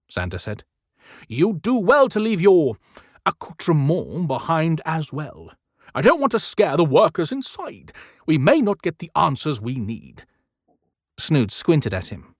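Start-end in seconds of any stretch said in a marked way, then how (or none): 1.3-10.3 lightly voiced firm old man; mild warning, a bit raised; Santa's voice. Narrator voices Santa character.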